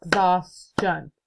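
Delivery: slow, beat by beat; clapped out by syllable. Shown as a demonstration. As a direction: tap out each syllable for the class. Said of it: "Let's clap it out".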